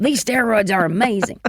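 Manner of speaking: put-on light generalized Southern accent. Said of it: "my generalized Southern accent".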